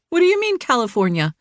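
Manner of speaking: incredulous. A narrator's reading. Jilly incredulous.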